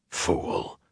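whispered self-reproach; self-loathing. Acted. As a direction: hiss it at yourself in disgust. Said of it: "Tom called himself".

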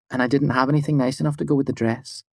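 put-on high, quick girlish voice; light shy young woman; gentle young woman.

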